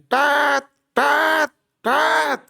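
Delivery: high cawing tat tat tat; mock crow cawing, pitched well up. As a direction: squawk like a morning crow. Burung gagak.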